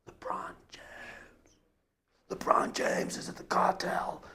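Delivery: breathless starstruck whisper; awed whisper. Acted. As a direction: whisper it like you just saw your idol. Awestruck fan spotting LeBron.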